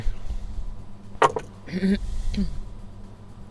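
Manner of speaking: clears throat hard; throat clearing. Real, not acted. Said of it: "I'm choking on mucus".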